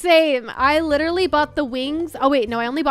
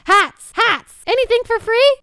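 loud, high, excited call; excited shout; 10 semitones higher, 8 dB louder than usual. Real, not acted. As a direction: call it out with delight. Spots hats in the shop.

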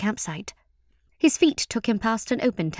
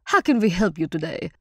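rich-voiced welcoming host; warm, rich voice. A narrator's reading. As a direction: offer help warmly, in character. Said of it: "in that warm, rich voice".

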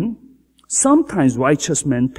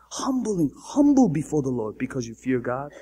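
hushed whisper; 2 semitones lower, 6 dB quieter than usual. Acted. Whispered aside.

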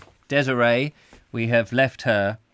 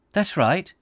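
much higher character voice; breezy confirmation; 9 semitones higher, 3 dB louder than usual. Acted. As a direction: answer brightly raised, as Desiree. Voicing Desiree's reply in story.

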